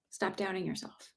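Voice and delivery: hushed echo of the urging; soft repeat. Shown as a demonstration. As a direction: let it land quietly the second time.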